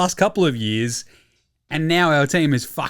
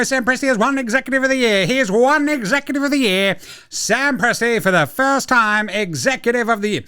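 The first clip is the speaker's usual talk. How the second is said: race caller; 7 semitones higher than usual. Shown as a demonstration. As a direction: call the news like a photo finish. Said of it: "Sounding like a race caller".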